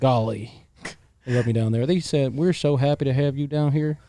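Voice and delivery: put-on drawn-out Southern hospitality accent; lightly put-on Southern welcome. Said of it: "That, that was good".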